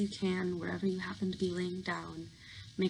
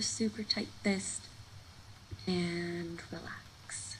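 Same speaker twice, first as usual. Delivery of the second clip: ordinary talk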